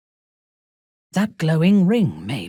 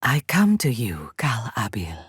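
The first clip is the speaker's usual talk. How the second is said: hushed reverent whisper; whispered prayer; 5 semitones lower than usual. Acted. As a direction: pray it under your breath. Prayer to a god.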